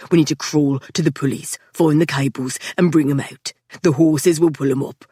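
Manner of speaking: a hint of a tense foreman laying it out; lightly voiced Patrick. A narrator's reading. Patrick explains the rescue plan.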